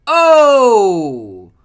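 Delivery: high, very loud drawn-out cry; long yelled "oh". Retold or imitated. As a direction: bellow a long falling ohhh. Acting out a yelled exclamation.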